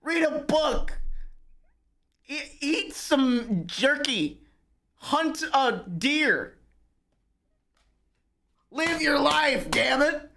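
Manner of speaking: drawn-out exasperated advice; exasperated mock preaching. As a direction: lecture them slowly and pointedly. Ranting lecture to internet people.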